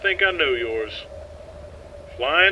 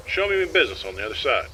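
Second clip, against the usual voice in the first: ordinary talk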